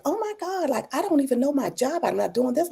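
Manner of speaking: high-pitched retold fretting; emotional overreaction. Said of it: "I'm just all in my feelings".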